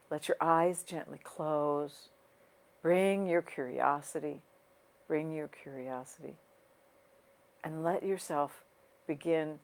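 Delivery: unhurried guiding voice with long pauses, softening toward the end; guided meditation. Real, not acted. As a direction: ease listeners into their breathing, leaving space between phrases. Leads a breathing meditation.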